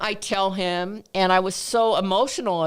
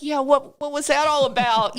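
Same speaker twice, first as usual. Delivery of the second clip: retold bafflement; 7 semitones higher than usual. Acts out her baffled reply.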